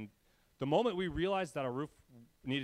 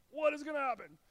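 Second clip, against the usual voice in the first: mock panic; 13 semitones higher than usual. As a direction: panicky question, pitched much higher. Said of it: "I'm, like, freaking out".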